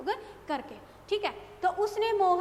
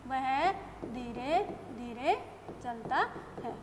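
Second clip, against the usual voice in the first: dictating word by word, slow and soft; pauses between words as if dictating; slow dictation; 3 semitones lower, 4 dB quieter, slower than usual. Shown as a demonstration. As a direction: spell it out slowly for students to write. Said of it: "क्या लिख दिया मैंने?".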